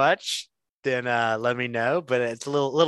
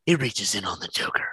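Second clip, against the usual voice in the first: whispering; 4 semitones lower than usual. Acted. Narrating a Batman skit.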